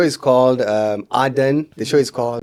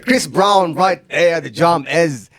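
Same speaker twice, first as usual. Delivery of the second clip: loud, high hype DJ voice; radio hype voice; 7 semitones higher, 7 dB louder than usual. Retold or imitated. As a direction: pump the crowd like a club DJ. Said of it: "This is not how I sound".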